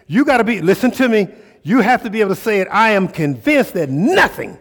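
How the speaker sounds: loud, high, fired-up preaching; full-force preaching. Real, not acted. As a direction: preach it hard to the crowd, almost shouting.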